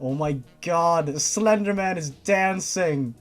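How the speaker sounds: ordinary delivery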